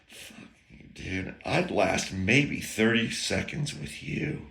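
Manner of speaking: soft, unvoiced, slow muttered reply; muttered curse. To Heinz at the bottom of the stairs, a slow muttered curse. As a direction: mutter it under your breath, slowly. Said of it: "I'm like".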